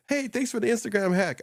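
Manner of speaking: high mock-chirpy thanks; grateful viewer. A follower thanking the host for the hack, high and chirpy. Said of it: "They go like".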